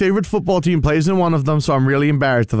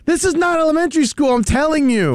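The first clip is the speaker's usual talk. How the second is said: high-pitched protest; 12 semitones higher than usual. Real, not acted. Protesting the school jab.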